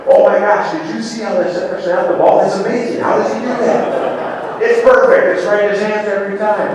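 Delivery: high, giddy, amazed fan voice; gushing fan. Retold or imitated. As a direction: squeal it like a starstruck fan. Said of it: "you've never been like".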